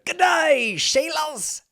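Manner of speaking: high, loud, hammed-up Aussie holler; Pilot Pete's Australian accent. Host recalling a fantasy suite entrance, shouted mock Australian accent. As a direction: belt it out in a bad Australian accent. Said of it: "in his best Australian accent".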